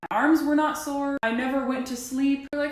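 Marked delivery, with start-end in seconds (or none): none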